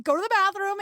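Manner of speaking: high-pitched order from an insistent grandma; mild mock yell, high voice; imitated grandma yelling. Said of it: "my grandma was ... yelling at me".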